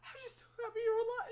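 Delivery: high, loud, fast through tears; weeping relief. Acted. Queen sobbing with relief at her daughter's return, high and fast. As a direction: gush through happy sobs. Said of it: "she just goes".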